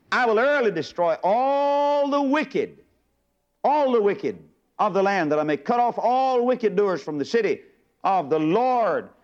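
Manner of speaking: high, slow ringing declamation; fervent scripture reading. Acted. Preacher to congregation, ringing slow proclamation. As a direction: proclaim each word of judgment. Scripture read with rising fervour.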